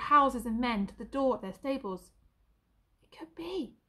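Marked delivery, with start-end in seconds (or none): 3.0-3.8 hushed, almost whispered; said quietly. A narrator's reading. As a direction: breathe it in disbelief. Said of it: "said Albert quietly".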